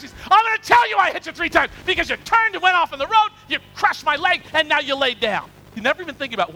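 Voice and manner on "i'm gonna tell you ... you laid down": heated comic rant, raised pitch; mock-angry retort. Balaam telling off the donkey, mock-angry loud retort. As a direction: argue back at full tilt, hammed up.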